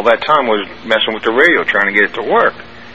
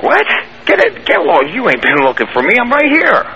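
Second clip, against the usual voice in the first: incredulous outburst; 10 semitones higher than usual. Retold or imitated. Disoriented soldier yelling back.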